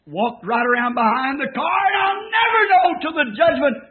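high, loud climbing cry; preacher shouting the miracle; rising preacher fervor. Real, not acted. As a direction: lift it up to a peak.

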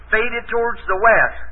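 "Faded towards the west" spaced out slowly; slow for the listeners. Real, not acted. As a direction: say it slowly so people can get it. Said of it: "talk slow now so the people can get it".

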